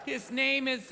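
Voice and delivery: usual speaking voice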